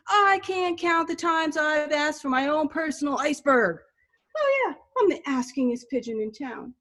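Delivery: pigeon working up to a freak-out; hammed-up aggrieved pigeon rant. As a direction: rant like a put-upon pigeon, building to a high wail. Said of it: "he goes on these little rants".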